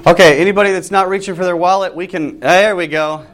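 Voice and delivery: ordinary delivery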